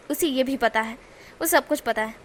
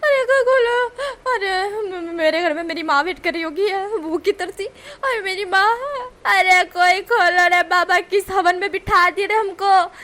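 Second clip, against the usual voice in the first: high, loud hammed-up wailing sobs; fake crying; 6 semitones higher, 6 dB louder, slower than usual. Wing pretending in the lift, loud faked crying. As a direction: fake sob for your mother. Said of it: "वो pretend करते हुए कहता है".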